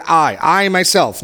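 "I, I myself" slow, every word leaned on; slow down and punch "I" and "myself"; emphasis on I myself. Shown as a demonstration. Teacher points the class to God's emphatic "I, I myself", stressed slowly. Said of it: "God is claiming firsthand".

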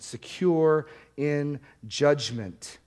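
slow emphatic repeat. Shown as a demonstration. Repeats phrase slowly for emphasis.

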